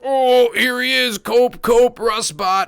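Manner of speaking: high, loud jeering taunt, slow at first; mocking internet taunters. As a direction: jeer like a smug online troll. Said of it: "They're like".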